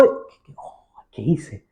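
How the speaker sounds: a shaken Moses, half whispered; stunned disbelief. Retold or imitated. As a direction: breathe out the words as if dazed. Moses stunned after the sea.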